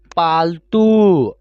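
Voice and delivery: पालतू read slowly with the final ऊ held long; stretched long vowel. Shown as a demonstration. Teacher showing students how to stretch the long ऊ. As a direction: drag out the ऊ in पालतू. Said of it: "खींच कर पढ़ रहे हैं".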